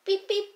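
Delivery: high, short imitated beeps; high beeping. Boys' gang arriving, high beep-beep noise. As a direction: beep like a little horn.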